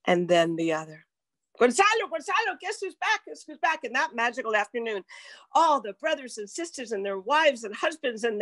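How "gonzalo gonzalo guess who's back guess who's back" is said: much higher, eager call to a husband; a retold excited shout to Gonzalo. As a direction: call across the yard with happy news. Calling excitedly across the party.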